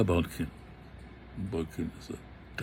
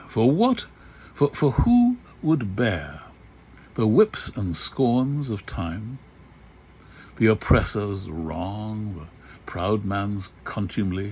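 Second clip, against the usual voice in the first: dramatic recitation; 4 dB louder, slower than usual. Shown as a demonstration. Lecturer recites soliloquy.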